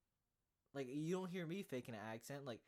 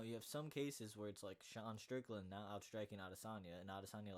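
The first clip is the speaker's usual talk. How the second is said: ordinary talk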